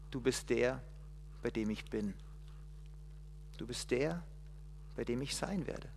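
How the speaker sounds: soft, low and tender; reassuring God. Acted. God telling Moses he will be with him, soft. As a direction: promise gently, close.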